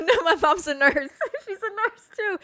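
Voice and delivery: high-pitched, laughing through the words. Real, not acted. Storyteller explaining mom is a nurse, real laughter. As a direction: crack up while explaining.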